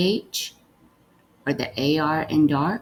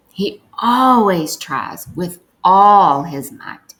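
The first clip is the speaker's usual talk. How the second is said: louder, emphatic reading with feeling; with emotion; 8 dB louder, slower than usual. Shown as a demonstration. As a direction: read it with real emotion. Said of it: "read it with some emotion".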